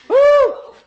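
high, loud "woo"; sudden exultant holler; excited whoop. Real, not acted. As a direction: let out a piercing preacher's whoop. Preacher whoops mid-sermon.

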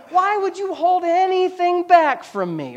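very slow, high, flirting plea; flirty Delilah. Retold or imitated. Delilah batting her eyes at Samson, slow high flirt. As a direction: drag it out, batting your eyes.

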